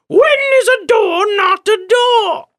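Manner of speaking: slow, very high, wailing shriek; loud, drawn-out eerie screech; eerie crone shout. Acted. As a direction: shout it like a spooky old hag. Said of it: "she shouted eerily".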